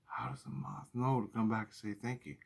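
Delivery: softly whispered question; whispered wonder. Retold or imitated. Asking himself about the moth, whispered in awe. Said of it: "I ask the question".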